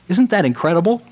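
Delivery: normal talking voice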